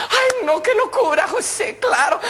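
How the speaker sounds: very high comic female squeal; thrilled woman. Acted. Paola thrilled José will return, gushing. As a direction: gush in a falsetto.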